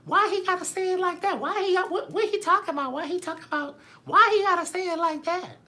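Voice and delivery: very high-pitched mock-offended listeners; mocking viewers' voices. Imitating insensitive viewers reacting to his joke, mocking high voice. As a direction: squeak it like a scandalized audience. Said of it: "that's how I imagine y'all voices to be".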